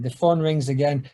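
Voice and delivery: ordinary talk, nothing marked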